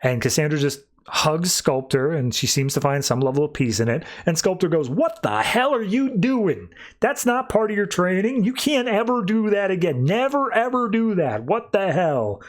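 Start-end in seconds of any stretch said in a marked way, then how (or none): 4.9-12.4 high-pitched mock outrage; outraged reprimand. Sculptor rebuking Cassandra for hugging her, outraged. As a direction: act out an appalled scolding, pitched way up.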